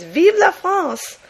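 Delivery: "Vive la France" high, slow and loud in cartoon French; mock French voice. Patriotic comic bystander, hammed-up mock French. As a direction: stretch it out like a stage Frenchman. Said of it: "another one says".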